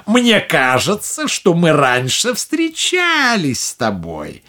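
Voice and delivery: put-on slow, sly old witch. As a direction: taunt her slyly. Witch taunts captive.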